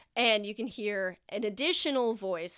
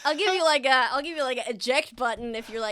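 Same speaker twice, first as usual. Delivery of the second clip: louder and higher, eager and bubbly; excited offer; 4 semitones higher, 9 dB louder, faster than usual. Real, not acted. GM eagerly offering player a way out, loud and bright. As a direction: pitch it with a grin.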